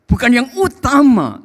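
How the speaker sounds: loud, high preacher's cry; impassioned preaching. Acted. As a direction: call out to God with force. Preacher cries out a prayer.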